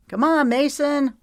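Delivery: high, loud call across the beach. Acted. Teammate calls Mason back to the game, loud call. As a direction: shout to a teammate far off. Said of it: "called out to him".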